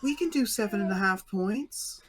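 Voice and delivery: comically alarmed high plea; mock panicked businessmen. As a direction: plead in mock alarm, voice jumped way up. Imitating panicked businessmen at a meeting.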